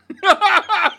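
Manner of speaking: sudden high squeal of a laugh; high burst of laughter. Real, not acted. Reviewer recalling the kid's face, high laughing squeal. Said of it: "I'm glad I'm not the only one".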